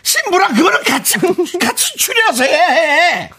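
laugh-crying. Acted. Laughing-crying mock wedding plea.

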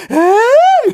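high, loud jeering "에이"; mock booing shout. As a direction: jeer like the staff in the back. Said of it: "이러면서".

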